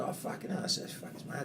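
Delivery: breathless whispered swear; stunned whisper. Retold or imitated. Man learning whose daughter it is, whispered shock. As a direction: hush it out in disbelief.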